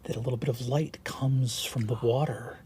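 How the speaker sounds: soft, low game master narration of the forest; hushed scene setting. Acted. Game master to players, hushed scene-setting.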